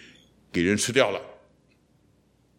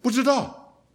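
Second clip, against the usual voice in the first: normal talking voice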